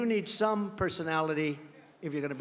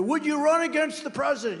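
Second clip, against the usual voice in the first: mock screaming question; 9 semitones higher, 4 dB louder than usual. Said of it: "these maniacs are screaming".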